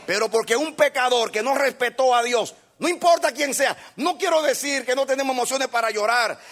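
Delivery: shouted, high sermon climax; rally the church with every line; fiery preacher hammering each repeated lloren; preaching at full force. Acted. Preacher shouting his main point.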